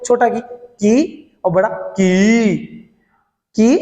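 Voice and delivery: की said short, then की drawn long. Shown as a demonstration. Teacher contrasting short and long की for students. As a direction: contrast a clipped की with a stretched की. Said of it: "अब देखना बड़ा की कैसे बोलते हैं".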